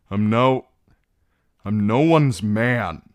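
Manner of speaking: loud, broken shout; anguished outburst. Acted. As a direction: tear the words out in shame. Theon wrenches away, shouting.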